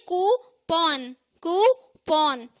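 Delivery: कूपन slowly split as "koo - pawn"; correct pronunciation. Shown as a demonstration. Teacher correcting learners, कूपन as slow "koo - pawn". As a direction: say कूपन in two drawn-out parts, full "pawn". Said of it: "क्या कहेंगे?".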